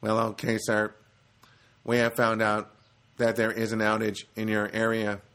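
monotone robotic phone agent. Retold or imitated. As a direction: recite it evenly like a machine. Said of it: "like a robot".

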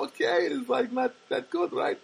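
put-on high, slow girl voice; mimicked Armenian girl. Girl with English as second language checking meaning, high mimicked voice. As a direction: explain it haltingly in her high voice. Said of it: "she talks like this".

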